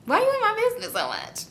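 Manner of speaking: high-pitched, indignant comeback; indignant retort. Retold or imitated. Woman retorting to a friend prying into her breakup, indignant. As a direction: push the voice way up, mock-offended.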